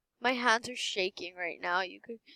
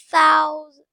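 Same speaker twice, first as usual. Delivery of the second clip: loud, high burst on the number; excited shout; 6 semitones higher, 11 dB louder than usual. Real, not acted. Player seeing the money hit a thousand, excited shout. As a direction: shout it high as the count climbs. Balance nears a million dollars.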